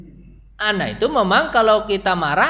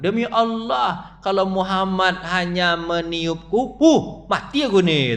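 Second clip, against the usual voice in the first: slow fearful oath; slower than usual. Retold or imitated. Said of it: "kata dia kan".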